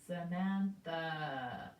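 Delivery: very slow, syllable by syllable; slow sounding out. Shown as a demonstration. Teacher sounds out the name to hear each A. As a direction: draw Samantha out. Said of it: "an a, apple A".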